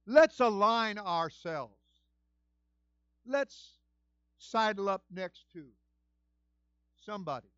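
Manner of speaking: usual speaking voice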